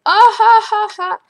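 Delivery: high, loud mock taunting laugh; gloating laugh. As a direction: laugh in the noob's face.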